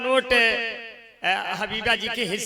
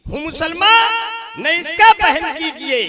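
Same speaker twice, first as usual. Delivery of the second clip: declaimed shout; 5 semitones higher, 7 dB louder, slower than usual. Acted. Repeated, declaimed at full voice.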